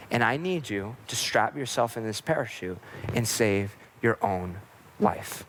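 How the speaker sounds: low, steady, composed appeal; very calm. Acted. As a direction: reassure calmly while urging them to act. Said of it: "very calmly and says".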